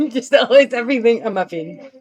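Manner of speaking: talking through a giggle; amused, laughing while speaking. Real, not acted. Laughing at own naming habit.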